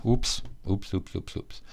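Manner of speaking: ordinary delivery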